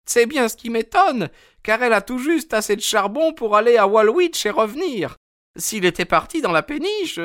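high, fretful woman's voice; a wife anxious about her missing husband; worried landlady. A narrator's reading. Mrs Smith voiced, worried. (femme inquiète, voix aiguë)

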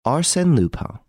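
high, emphatic self-introduction; name reveal. A narrator's reading. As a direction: announce your name as a reveal. Lupin reveals his name to Varin.

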